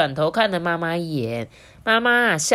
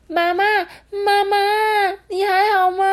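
put-on very high, loud frightened child; panicked calling; 7 dB louder than usual. A narrator's reading. Little Lemon calls to injured mum, panicked shouting. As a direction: keep crying out for mum in alarm. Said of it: "慌张的一直喊着".

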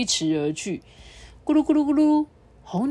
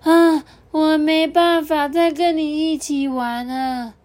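high, loud and slow, panting; puffed-out little button; out of breath; 9 semitones higher, 6 dB louder, slower than usual. Acted. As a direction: gasp the words out tired. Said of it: "气喘吁吁地说".

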